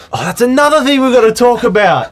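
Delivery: high, excited realization; sudden excited outburst. Real, not acted. Sudden excited recall, both react.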